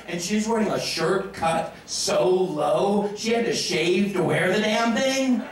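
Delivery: ordinary talk, nothing marked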